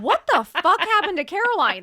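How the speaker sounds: high, loud laughing disbelief; laughing self-talk. Retold or imitated. Mocking herself in the mirror, laughing and loud. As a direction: crack up at your own reflection. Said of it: "I just remember being like".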